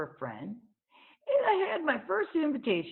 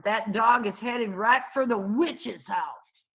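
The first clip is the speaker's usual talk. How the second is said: mock warning; 4 semitones higher, 6 dB louder, slower than usual. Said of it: "Dunlap hollered".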